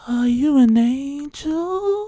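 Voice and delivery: weird chick's slow, high, drawn-out come-on; put-on slow, high-pitched oddball voice; Pauly Shore whisper. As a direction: stretch it out high and slow, like a creepy admirer in someone's ear. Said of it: "she had a voice just like Pauly Shore".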